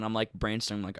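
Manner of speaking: normal talking voice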